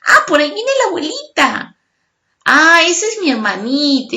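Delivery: put-on a kid spotting family; excited child. Child recognizing grandma and sister. (niño emocionado reconociendo a la abuelita)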